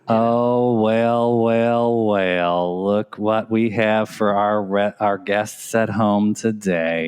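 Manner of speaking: slow southern drawl. Pretend. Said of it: "that slow Southern drawl".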